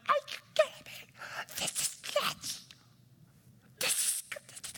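voiceless, incredulous whisper; whispered freak-out. Retold or imitated. Preacher reliving late-night study discovery as kids sleep, whispered freak-out. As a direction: freak out under your breath. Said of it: "I'm like, I'm freaking out".

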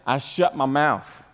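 normal talking voice

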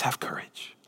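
breathy hush, almost no voice; whispered comfort. Acted. Jesus whispering courage to frightened Peter, hushed. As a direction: whisper it like a gentle promise.

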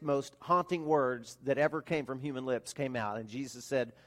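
usual speaking voice

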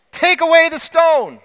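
high, loud call to open the tomb; loud command. Retold or imitated. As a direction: shout it out like a command at the grave. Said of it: "he said in a loud voice".

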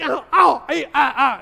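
loud, high ouch yelps; hammed-up ow ow ow; mock pain yelps. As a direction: hop around yelping from stepped-on Legos. Stepping on Legos.

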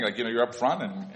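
ordinary talk, nothing marked

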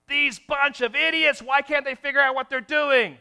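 high, loud, scornful outburst; a hammed-up fed-up candidate venting; mock angry rant. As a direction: rail at them as fools. Imagined insult at the employer.

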